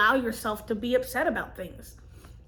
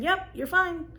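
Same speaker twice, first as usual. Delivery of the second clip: higher-pitched, mild imitation of a reassuring doctor; lightly voiced all-clear, pitched up; breezy reassurance; 8 semitones higher than usual. Doctors telling her the check-up was fine, breezily reassuring.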